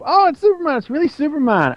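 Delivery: put-on high, excited kid voice gasping in awe; awestruck kids. Tiny Titans spotting Superman, high and awestruck. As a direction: squeal like little kids seeing their hero. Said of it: "all the Tiny Titans look up".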